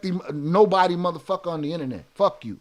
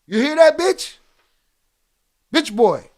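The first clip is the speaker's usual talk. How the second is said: loud, higher jeering call-out; taunting shout; 7 semitones higher, 8 dB louder than usual. Real, not acted. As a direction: yell it to provoke him. Taunting a commenter who left.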